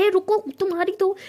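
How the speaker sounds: high, loud yell after him; hammed-up furious yell; angry shout. Pari charges at the man.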